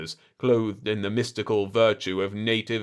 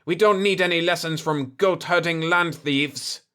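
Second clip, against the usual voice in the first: raised, louder angry outburst; angry blurt; 8 semitones higher, 5 dB louder than usual. Acted. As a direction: blurt the insult hotly. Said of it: "Georges blurted".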